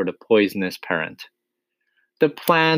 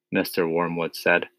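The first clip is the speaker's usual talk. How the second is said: usual speaking voice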